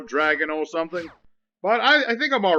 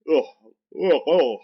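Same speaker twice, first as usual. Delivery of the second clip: yawning. Real, not acted. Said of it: "oh, what am I yawning?".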